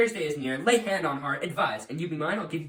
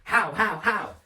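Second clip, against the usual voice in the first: louder, higher angry repeated how; incredulous outburst; 5 semitones higher, 6 dB louder, slower than usual. Acted. Father can't believe his daughter's answer, angry outburst. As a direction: bark out the disbelief.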